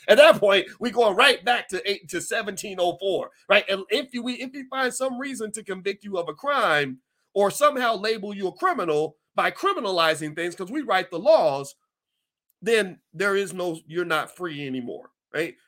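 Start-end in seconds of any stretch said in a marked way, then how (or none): none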